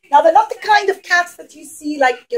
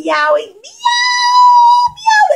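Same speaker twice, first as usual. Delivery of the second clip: rising, high cat meow; 5 dB louder than usual. Pretend. Teacher making the cat sound for children, high meow. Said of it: "the cat started meowing".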